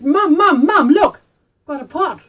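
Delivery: loud, high, eager calls to mum; excited calling. Acted. Tui running home to show Mum the pot, excited and loud. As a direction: bounce in the door shouting for mum.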